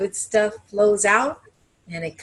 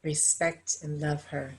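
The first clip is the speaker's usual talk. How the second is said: blessing prayer; 2 semitones lower than usual. Acted. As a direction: bless the room gently, like a healer. Leads a closing blessing.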